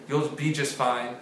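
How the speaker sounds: ordinary talk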